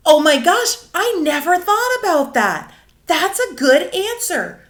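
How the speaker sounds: loud and high, delighted by the answer; excited praise. Pretend. Teacher to student, loud delighted praise. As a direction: cheer the child on.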